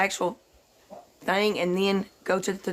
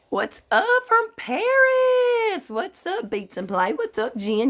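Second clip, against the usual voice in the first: high, slow drawn-out greeting; excited high greeting; 10 semitones higher, slower than usual. Real, not acted. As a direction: sing the hello up high.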